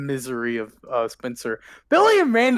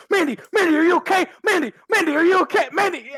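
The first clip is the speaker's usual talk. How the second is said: high-pitched, frantic calling out; Billy; 10 semitones higher, 4 dB louder, much faster than usual. Acted. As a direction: fret over a fallen friend, repeating urgently. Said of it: "And Billy goes".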